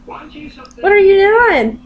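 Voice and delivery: high, drawn-out fussing; pet talk. Real, not acted. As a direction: draw out a cooing question to a pet. Fussing over the pet bird.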